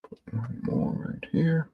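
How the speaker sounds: soft unvoiced whisper. Real, not acted. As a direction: breathe it while focusing. Murmured to self while painting.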